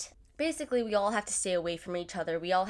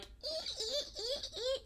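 high thin squeak, ee ee, with a laugh; squeaky wiper noise; 9 dB quieter than usual. Retold or imitated. Imitating squeaking wipers on the snowy drive, high squeal. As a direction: mimic broken wipers squeaking on glass. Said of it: "and they're like".

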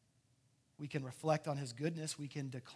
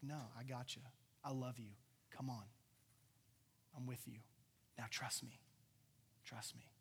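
soft, low, tender encouragement; gentle reassurance; 5 semitones lower, 12 dB quieter than usual. Acted. Jesus lifts drowning Peter from the storm, gently reassuring. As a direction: reassure quietly, with space between phrases. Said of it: "angry at him".

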